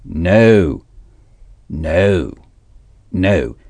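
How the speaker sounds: No, voyelle ronde, tombante, répétée; model no, repeated. Shown as a demonstration. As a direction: drill No for the student. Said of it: "Once more". (modèle : No répété, descendant)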